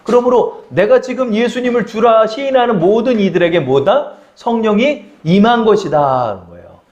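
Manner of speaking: ordinary talk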